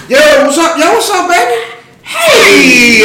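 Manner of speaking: high-pitched, overfriendly mock greeting; voice shooting up. Lying that he knows you, voice climbing high. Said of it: "voice go up like two octaves".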